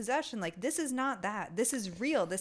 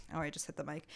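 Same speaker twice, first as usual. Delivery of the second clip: ordinary delivery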